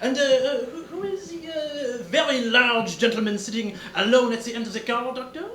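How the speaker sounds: lightly hammed French detective, pleased with himself; French accent. Detective showing off to the countess, French accent. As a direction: lay out the deduction with Gallic flair.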